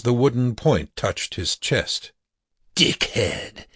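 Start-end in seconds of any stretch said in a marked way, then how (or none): 2.7-3.7 whispered vampire sneer; hissed insult. A narrator's reading. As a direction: breathe the insult with contempt. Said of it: "he said".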